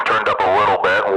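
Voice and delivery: ordinary talk, nothing marked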